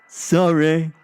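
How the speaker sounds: loud, high, hammed-up "sorry"; melodramatic mock apology. A narrator's reading. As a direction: poke at someone with an overblown apology. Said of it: "inappropriate supporting melodramatic body language".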